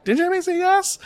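put-on very high, tiny toddler squeak; little girl voice. Father replaying his daughter's reply to her brother. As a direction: shoot the pitch way up like a small girl. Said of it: "three-year-old little girl voice".

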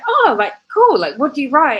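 curious husband. Retold or imitated. Future husband asking what she writes, high and curious. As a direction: mimic him perking up with curiosity. Husband asks about her hobbies.

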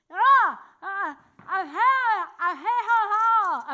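loud, high mouth-open sounds; locked-jaw talk. Retold or imitated. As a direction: speak with the jaw stuck wide open. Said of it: "and I'm like".

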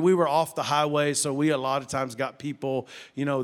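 ordinary talk, nothing marked

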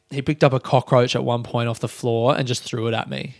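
ordinary talk

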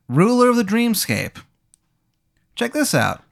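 very high clown voice; Dreamy Dan. Acted. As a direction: falsetto clown showing off his powers. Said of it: "Dreamy Dan".

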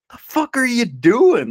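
high, slow, loud disbelieving bark; mock exasperated yell. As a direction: act baffled at a friend's snack choice. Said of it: "And I'm just like".

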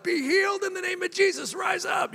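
high, ringing shout of a healing prayer; loud prayer shout. Retold or imitated. Preacher shouting a healing prayer over the coma patient. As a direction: cry it out like a preacher commanding healing. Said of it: "screaming my bloody head off".

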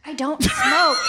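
loud, high indignant denial; shouting. Pretend. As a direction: shout it back in protest. Denying she smokes, mock outrage.

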